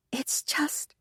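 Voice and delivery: sudden breathless whisper, cut short; an unvoiced, nervous it's just. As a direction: drop to a whisper and break off. Maid breaks off, seeing Andrea.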